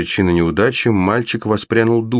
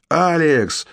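much higher shout of joy; joyful yell; 9 semitones higher than usual. Acted. Brother yells with joy seeing his sister alive. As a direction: cry her name in delight. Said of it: "заорал Коннор вне себя от радости".

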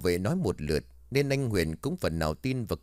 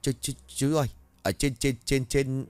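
higher, stammering in fright; Mạnh stammering in fear to the guard; terrified stammer; 5 semitones higher than usual. Acted. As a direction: stutter it out, too scared to speak. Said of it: "bắt đầu thều thào".

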